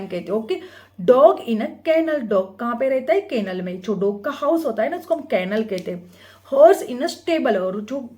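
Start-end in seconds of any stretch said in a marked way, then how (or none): 1.0-2.2 slow and louder, each word clear; slow reading aloud. Shown as a demonstration. Teacher reading the book line to students. 6.5-7.6 slow and louder, each word clear; slow reading aloud. Shown as a demonstration. Teacher reading the book line to students.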